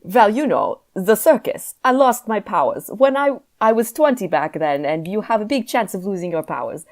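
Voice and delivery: mock European accent, loosely held; vague foreign accent. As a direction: put on some unplaceable foreign accent. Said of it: "He's just getting a vague foreign accent".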